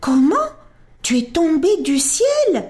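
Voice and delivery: astonished little prince; exclaiming. A narrator's reading. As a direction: cry out in amazement. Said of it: "Alors il s'écria".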